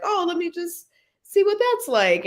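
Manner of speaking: put-on a mild, high tease of her old curiosity; curious younger self. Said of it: "it was like".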